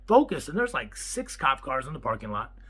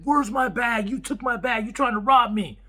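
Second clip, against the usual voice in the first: raised, accusing mock yell; retold yelling; 8 semitones higher, 5 dB louder than usual. Bearded guy accusing the owner over the counter: mock yell. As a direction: play the angry customer half-heartedly. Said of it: "this dude just yelling".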